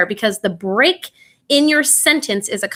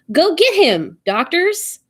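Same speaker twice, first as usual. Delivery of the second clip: "Go get him" high and punchy, pause, "doctors" rising; pause before doctors; 8 semitones higher, slower than usual. Shown as a demonstration. Teacher demonstrating moved comma, high "Go get him", pause, "doctors". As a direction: stress "get", break, then address the "doctors". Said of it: "Placing the comma in a different spot".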